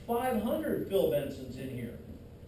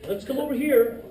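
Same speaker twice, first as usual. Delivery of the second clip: ordinary talk, nothing marked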